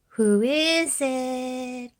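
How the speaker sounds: high, slow call through the door; Granny calls slowly to the door. A narrator's reading. Granny calling out, drawn out and high. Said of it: "called out Granny".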